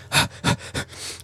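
quick unvoiced huffs of breath; running pants. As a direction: pant as if sprinting. Voices a runner's panting.

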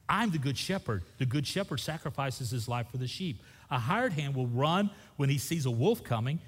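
preacher reading John aloud to the church; scripture reading. Acted. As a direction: proclaim the Bible passage to the congregation. Reads scripture passage aloud.

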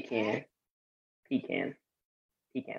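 very soft, under the breath; small, low PEE-can for "Pecan"; quiet counter-correction. Real, not acted. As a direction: slip it in quietly, again and again. Muttering own pronunciation against the other's.

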